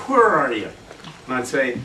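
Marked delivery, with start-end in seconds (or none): 0.1-0.7 imitated strong Tennessee twang, falling; Tennessee accent. Guitarist repeating his mentor's question, strong Tennessee accent. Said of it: "that real strong Tennessee accent".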